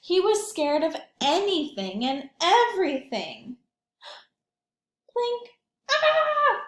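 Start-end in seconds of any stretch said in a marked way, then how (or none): 4.0-5.5 high gasp then a small scared word; startled gasp. Acted. Reading to children, the scaredy cat Sam jumps with a frightened gasp. 5.9-6.6 high, loud frightened shriek; scared shriek. Acted. Sam the scaredy cat gets frightened, high scared shriek.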